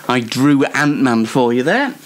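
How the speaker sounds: usual speaking voice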